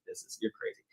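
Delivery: unvoiced hushed quote of the wife; hushed you're crazy from the wife; whispered aside. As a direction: whisper it like a private scolding. Wife muttering on the steps.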